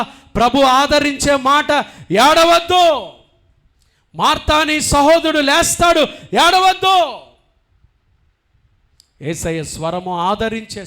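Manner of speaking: loud, high, fervent preaching; preaching at full force. Acted. Pastor proclaims Jesus' comfort to the congregation, fervent full-force preaching. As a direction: lift the congregation with a ringing sermon voice.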